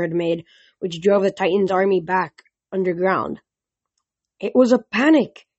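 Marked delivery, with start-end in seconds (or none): 4.4-5.5 firm, high insistence from Juniper; insisting. Acted. Juniper pushing back before the council, insisting. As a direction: press the point, pitched up. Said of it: "insisted Juniper".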